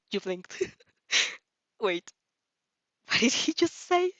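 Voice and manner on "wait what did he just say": startled disbelief. Real, not acted. Reader startled by his answer.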